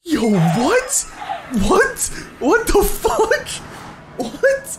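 loud, very high shrieks of disbelief; astonished. Real, not acted. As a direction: squeal each question in amazement. Gamer astonished by a lit portal.

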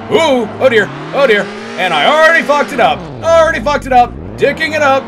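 high, loud, rattled outburst; flustered exclaiming. Real, not acted. Player wrecking the car at once, loud dismay. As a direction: cry out as it all goes wrong.

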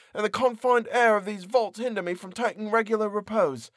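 high, despairing outburst; anguished cry. Acted. Prisoner cries out alone in the vault, high and anguished. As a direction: cry out at the edge of madness.

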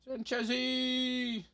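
taunting. Real, not acted. Taunting hunted enemy player.

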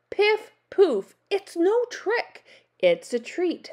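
high, punchy storybook witch; insistent witch. A narrator's reading. Witch assuring the ghost, brightly insistent. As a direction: pop the first words high, then insist.

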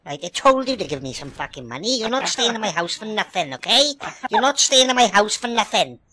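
usual speaking voice

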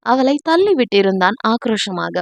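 ordinary delivery

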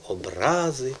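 "образи" with a lengthened РА; "образи" as обРАзи, falling after the middle; stress on second syllable. Shown as a demonstration. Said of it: "ще один наголос".

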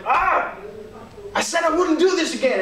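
high, fast cry of frustration; hammed-up desperate wail; acted desperation. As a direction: blurt it out high and hurried, exasperated at yourself. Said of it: "sometimes you get desperate".

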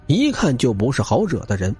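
plain reading voice

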